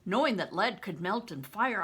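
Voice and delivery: ordinary delivery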